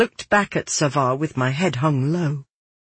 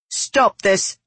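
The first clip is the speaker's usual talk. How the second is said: firm, higher-pitched command; strong and clear; 5 semitones higher than usual. A narrator's reading. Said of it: "my voice strong and clear".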